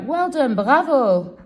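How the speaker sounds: high, loud congratulations; delighted praise. Real, not acted. Praising colleague's correct first word.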